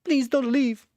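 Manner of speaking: high, loud put-on plea; mock pleading. Streamer begging viewers not to leave, hammed-up plea. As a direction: beg them to stay, hammed up.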